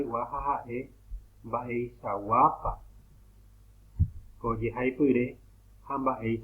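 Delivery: ordinary talk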